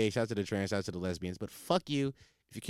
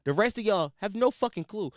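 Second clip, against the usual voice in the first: usual speaking voice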